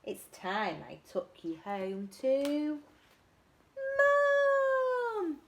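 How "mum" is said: high, loud cry of Mum; delighted call; joyful Mum. Acted. Little monkey calling out to Mum, high and joyful.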